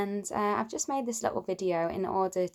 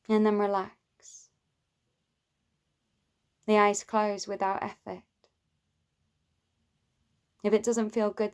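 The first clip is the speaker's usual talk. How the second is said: guided meditation. Acted. Teacher leads listeners towards love for oneself, calm guided meditation. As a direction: guide them gently inward, leaving space between phrases.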